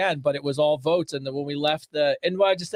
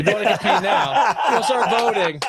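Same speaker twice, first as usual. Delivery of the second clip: laughing tease; 3 semitones higher than usual. Real, not acted. Cohost echoing challenge while laughing.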